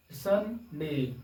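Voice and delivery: Sunday very slow, split as सं, डे; separated syllables. Shown as a demonstration. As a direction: stretch Sunday into two clear beats. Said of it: "separate करके समझाने के लिए". (Sunday को दो हिस्सों में धीरे बोलें)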